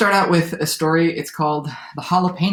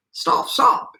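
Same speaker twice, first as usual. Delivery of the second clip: higher-pitched, half-voiced call to halt; mild shout; 10 semitones higher, 3 dB louder than usual. A narrator's reading. Javelinas try to halt the cowboy, mild shout. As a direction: call out stop lightly. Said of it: "shouted the javelinas".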